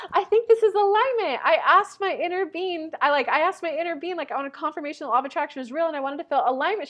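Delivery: high, breathless, rushing rapture; excited gushing. Retold or imitated. Retelling a passenger's Uber-ride breakthrough, high and gushing. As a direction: gush like a sudden revelation hit.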